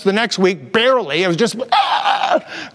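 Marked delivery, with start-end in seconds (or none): 1.7-2.7 strained lost voice. Shown as a demonstration. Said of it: "going like this".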